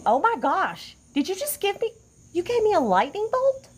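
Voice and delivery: high-pitched astonished reaction; surprised. Real, not acted. Host spotting a viewer's gift, high and amazed. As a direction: react in high, rising disbelief.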